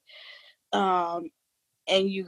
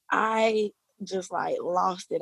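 ordinary delivery

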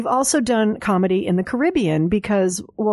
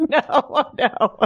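laughing; 5 semitones higher than usual. Real, not acted. Laughing at idea of singing.

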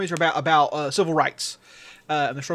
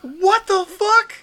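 shocked disbelief; 13 semitones higher, 6 dB louder, much slower than usual. Retold or imitated. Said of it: "And you're like".

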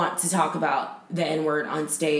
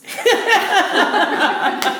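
high loud burst of laughter; loud high cackle; 13 semitones higher, 7 dB louder than usual. Real, not acted. Laughing at her own threat to slash tires.

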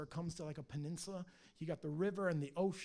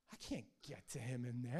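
lower, softer grumbling complaint; mock whine; 5 semitones lower, 5 dB quieter than usual. Satan grumbling to God about Job, whining. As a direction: sulk about being shut out. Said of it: "he whines to God".

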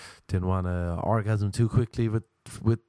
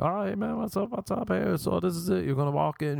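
higher-pitched casting director, lightly voiced; 8 semitones higher than usual. Casting director greeting an actor at an audition, lightly voiced. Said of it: "the casting director's like".

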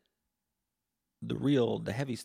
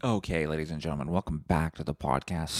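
fast, louder host welcome; podcast host intro; 4 semitones lower, 3 dB louder than usual. Acted. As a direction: announce the show, mock-formal. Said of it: "podcast host intro".

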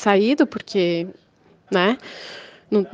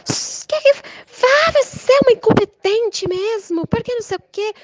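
imitating furious neighbor; 12 semitones higher than usual. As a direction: spit the insults in a shrill caricature. Angry neighbor insulting her.

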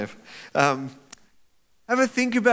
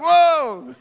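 high, loud mock start; startled whoa; 11 semitones higher, 9 dB louder than usual. Disciples startled by Jesus appearing, loud mock surprise. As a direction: jump at the sudden visitor.

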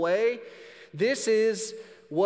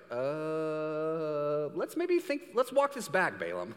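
God's voice; much slower than usual. Retold or imitated. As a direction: patiently talk a minister back. God gently correcting Balaam.

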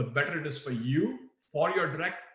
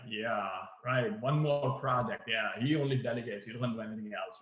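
eye-rolling sarcasm; 4 semitones lower, 4 dB quieter than usual. Shown as a demonstration. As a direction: lower and softer, muttered resentment. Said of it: "Or rolling your eyes like".

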